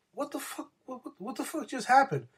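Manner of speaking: high, incredulous what the; stunned disbelief. Real, not acted. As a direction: pitch jumps way up in shock. Disbelief at Wilder's video.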